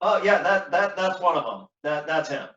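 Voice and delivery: wounded stammer. Acted. Wounded Cassio points out attacker.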